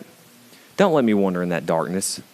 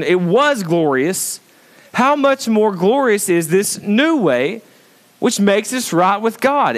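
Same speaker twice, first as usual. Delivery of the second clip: high, ringing sermon delivery; impassioned preaching; 10 semitones higher than usual. Acted. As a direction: proclaim it to the congregation, pitched up. Preaches the verse.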